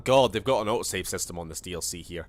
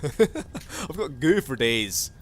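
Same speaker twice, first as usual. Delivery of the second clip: hammy boast; 5 semitones higher, 4 dB louder, much slower than usual. Pretend. Hammed goo boast.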